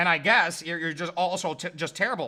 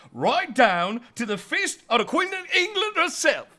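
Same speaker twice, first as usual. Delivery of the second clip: hammed-up ad pitch; 4 semitones higher than usual. Sales pitch.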